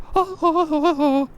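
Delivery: high cackling laugh; high, mocking cackle; mocking knight laugh. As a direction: laugh at the fool.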